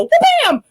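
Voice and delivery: a high, loud wha-bam; shouted comic strike noise; loud mock punch sound. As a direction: slam it out like a punch landing. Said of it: "I go".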